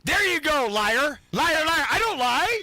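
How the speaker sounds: really fed up, call him out; angry accusation. Real, not acted. Calls co-host a liar.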